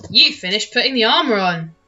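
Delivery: ordinary talk, nothing marked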